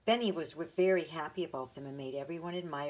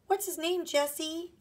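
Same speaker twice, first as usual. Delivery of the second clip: put-on much higher, louder child question; eager little boy; 11 semitones higher, 5 dB louder than usual. A narrator's reading. Benny eagerly asking his sister the bear's name. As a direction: ask like a bouncing small boy.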